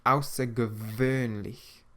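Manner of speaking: "Außergewöhnlich" slowly, the W as a clear V; "Außergewöhnlich" with a German V sound. Shown as a demonstration. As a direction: stretch out "Außergewöhnlich", V for the W. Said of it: "the W in German is pronounced like a V".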